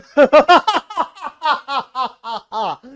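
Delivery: big show-off laugh; loud laugh. Shown as a demonstration. Teacher laughing hard like the class, a loud laugh. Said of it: "मैं भी इतनी जोर जोर से हंसूंगा फिर".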